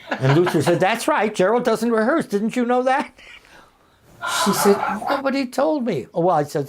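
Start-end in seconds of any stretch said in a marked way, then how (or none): 0.8-3.1 higher, insistent, rising to a question; emphatic backing up. Retold or imitated. Retells Luther chiming in, backing him. 5.1-6.1 high-pitched retold indignation; startled protest. Retells Shelley's startled protest.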